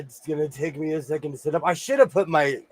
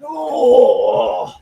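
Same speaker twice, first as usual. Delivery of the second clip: loud strained oh; straining exclamations; effortful grunts; 6 dB louder, much slower than usual. Real, not acted. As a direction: heave something heavy. Lifting heavy fabric pile.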